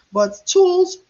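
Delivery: "tools" punched high and loud; emphatic tools. Real, not acted. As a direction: hit the word "tools" hard. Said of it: "listing key points".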